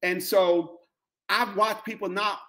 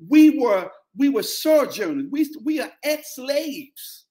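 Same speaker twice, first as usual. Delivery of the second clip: testifying prayer, voice lifted up and held; fervent preached prayer; 7 semitones higher than usual. Acted. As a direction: pray it out at full force like a pulpit climax. Preaching prayer at full force.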